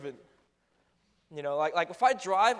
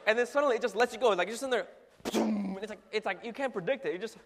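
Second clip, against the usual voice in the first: ordinary talk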